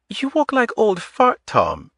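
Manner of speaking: put-on light Eastern European accent. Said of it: "In a light Eastern European accent".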